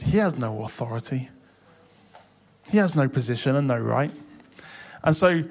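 usual speaking voice

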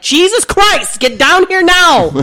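high, loud, slow exasperated demand. Retold or imitated. Finally ordering cleaning staff over, exasperated demand. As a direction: snap it, out of patience. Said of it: "had to finally be like".

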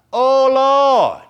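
long, high, loud cry to God; big sustained preacher's cry; crying out in prayer. Acted. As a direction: belt it out as a drawn-out plea. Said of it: "how did they pray?".

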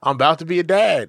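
ordinary delivery

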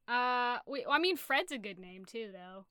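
normal talking voice

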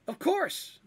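very high, bright Of course; high eager agreement; eager kender. Acted. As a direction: jump at the chance. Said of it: "said Polly".

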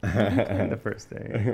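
slow, drawn-out teasing, rising at the end. Real, not acted. Teasing his partner about calling it a date. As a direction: stretch it out like air quotes.

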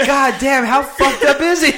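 high, loud, laughing through it; mock teammates' disbelief; laughing quote. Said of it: "they're all like".

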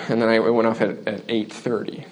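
ordinary talk, nothing marked

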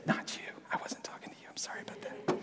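hushed apologetic whisper; sheepish whispered sorry; embarrassed whisper. Retold or imitated. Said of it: "and I was like".

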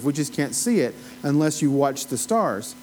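ordinary talk

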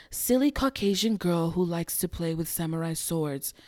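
soft, low menacing villain line; O-Ren Ishii quote. Retold or imitated. Host quoting O-Ren Ishii's Kill Bill line softly. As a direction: purr the threat coolly and softly. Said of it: "the part where she says".

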